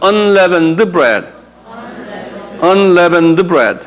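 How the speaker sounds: "Unleavened bread" drawn out very slowly, stress on "Unleavened"; slow model to repeat. Shown as a demonstration. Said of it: "Unleavened 한번 발음해봐".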